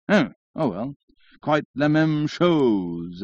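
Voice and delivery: very slow, affected drawl; drawling syllables. A narrator's reading. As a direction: stretch the French phrase right out. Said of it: "drawling out his syllables".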